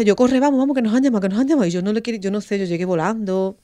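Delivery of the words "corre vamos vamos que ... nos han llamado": high, loud frantic rush to get going; panicked urging. Retold or imitated. Mother urging her husband to run, frantic. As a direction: hurry him, panicked and breathless.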